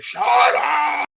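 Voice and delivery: high, loud yell of shut up; furious shout. Retold or imitated. Storming out to confront family, furious shout. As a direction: scream it like you've lost it. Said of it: "I storm out to confront my family".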